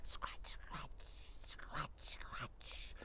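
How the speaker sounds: whispered scratch, scratch fading very soft; spooky whisper. A narrator's reading. Storyteller whispering the scratching at the window. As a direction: hush it to build the fright.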